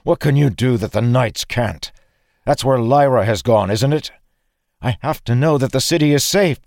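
high, loud, pressing questions; impassioned plea with rising pitch; urgent demand. A narrator's reading. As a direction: insist urgently mid-fight. Urgent questioning during fight.

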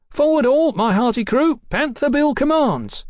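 put-on high boyish battle call; rallying cry. Boy gang leader rallies his crew.

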